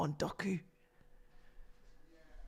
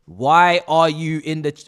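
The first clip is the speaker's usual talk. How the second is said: normal talking voice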